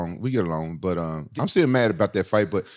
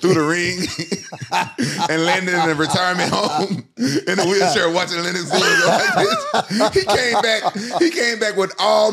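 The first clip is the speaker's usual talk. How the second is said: laughing while talking; 8 semitones higher, 4 dB louder, slower than usual. Real, not acted. Laughing through the funny edit.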